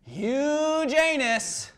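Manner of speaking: high, very stretched joke name; drawn-out announcement. Acted. Host introducing next week's guest, high and drawn out.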